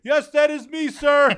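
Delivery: playing the eager servant, high and loud; mock formal reply. Answering the famous host in a squeaky mock-proud voice.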